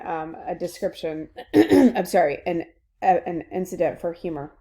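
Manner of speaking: ordinary talk, nothing marked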